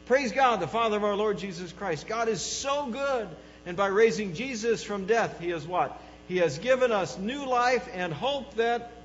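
high, loud, emphatic praise; exultant hope. Acted. Peter encouraging believers in difficult days, exultant praise. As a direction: proclaim it with rousing joy. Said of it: "an attitude of hope. He says".

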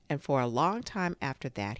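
plain reading voice

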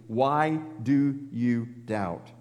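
gentle rebuke. Retold or imitated. Jesus gently rebuking Peter.

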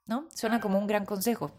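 ordinary delivery

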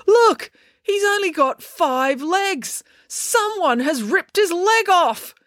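high, drawn-out cry of discovery; shocked outcry. Acted. Someone exposes the cockroach's ripped-off leg, shocked high cry. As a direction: cry out the outrage, slow and high.

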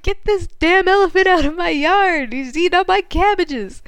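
high, loud outraged holler; mock irate farmer. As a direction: play a farmer yelling someone off the land. Farmer yells about elephant.

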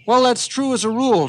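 put-on high, loud old grandpa; old man voice. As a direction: insist like a fibbing grandpa. Grandpa insists.

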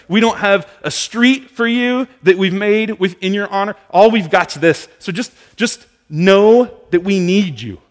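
high, loud, pleading crowd; desperate plea. Retold or imitated. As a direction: plead with him at full voice. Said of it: "They let themselves be true and say".

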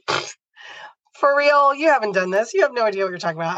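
scoff, high and fast, like the idea is absurd; incredulous scoff. Retold or imitated. To husband, dismissing his advice, scoffing in disbelief. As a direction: brush it off as ridiculous, rattling it out quickly.

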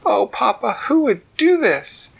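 a mother appealing to Papa; high alarmed mama voice; distressed Mama Bear. Acted. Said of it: "Mama said".

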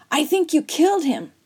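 plain reading voice

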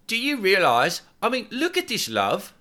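high insistent voice; urgent nudge. Acted. Girlfriend pestering her boyfriend, high urgent voice. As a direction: grab his attention, pitched high.

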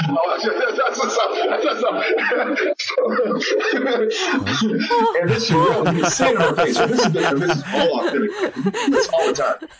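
high, helpless laughter through the words; laughing hard. Real, not acted. Storyteller cracks up over his own meeting story, laughing. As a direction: barely get the words out for laughing.

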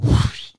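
loud breathy whoosh of a door flying open; imitated whoosh. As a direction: blow out a sudden rush of air. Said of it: "door flies up".

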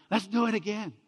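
high, loud, eager cheer; the pastor begging to redo the wedding, excited. Retold or imitated. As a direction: bounce it out like a kid. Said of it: "I was like".

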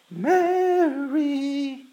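rejoicing call. Shown as a demonstration. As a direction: call out her name in joy. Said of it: "with her rejoicing".

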